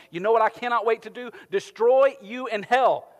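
loud, high gloating threat, slowing at the end; mock vengeful God. Preacher mocks the false image of a wrathful God, gloating loudly. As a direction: sneer it like a cruel tyrant. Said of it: "just seeking out and going".

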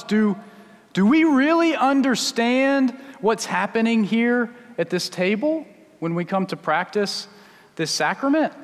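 ordinary delivery

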